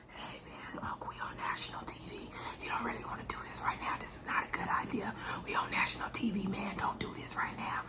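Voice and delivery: imitating an urgent, hushed whisper; retold drunken whisper. Martell warning his uncle they are on national TV, whispering. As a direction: whisper it like a tipsy secret everyone can hear. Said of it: "gets close and whispers into his ear".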